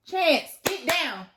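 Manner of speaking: firm command to a jumping dog; telling the dog off mid-video; scolding the dog. Real, not acted.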